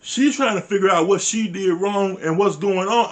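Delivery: normal talking voice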